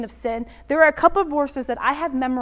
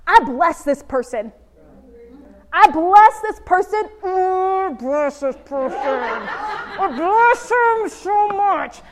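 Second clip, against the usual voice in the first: grudging blessing; 4 semitones higher, slower than usual. Retold or imitated. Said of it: "a little bit of that at the beginning".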